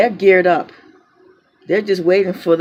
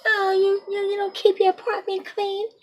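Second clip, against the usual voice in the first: much higher, sneering imitation; mocking accuser voice; 12 semitones higher, 4 dB quieter than usual. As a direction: mimic the accusation in a silly high voice. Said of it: "mocking accuser".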